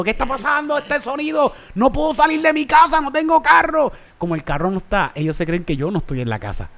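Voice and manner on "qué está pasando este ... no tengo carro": quoting his own panic, pitched well up; panicked high whine. Retold or imitated. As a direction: wail it like someone stuck at home tripping. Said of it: "gritando, ah, llorando".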